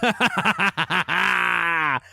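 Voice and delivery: long, high burst of laughter; triumphant laugh. Real, not acted. Co-host celebrating the deleted email app, triumphant high laugh.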